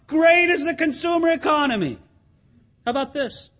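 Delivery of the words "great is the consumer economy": mock shoppers' cry. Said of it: "hear people crying out".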